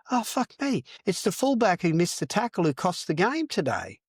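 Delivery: mocking punter. Punter mocking him in bar.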